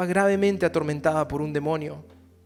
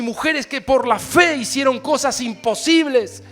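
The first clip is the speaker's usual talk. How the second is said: fiery preaching; 7 semitones higher, 12 dB louder than usual. Acted. Pastor shouting to his congregation, fiery preaching. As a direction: preach it out to the church.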